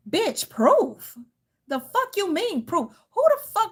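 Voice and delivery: indignant, rising outrage; outraged disbelief. Real, not acted. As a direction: hit it like you can't believe it. Outraged at demand for proof.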